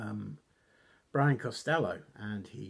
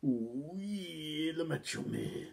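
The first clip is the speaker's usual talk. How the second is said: drawn-out, high imitation of a wrestler's catchphrase; strained attempt at a wrestler's put-on voice; Macho Man Randy Savage; 5 semitones higher, much slower than usual. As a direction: stretch it out in a badly faked wrestler voice. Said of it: "I can't do his voice, it's so hard".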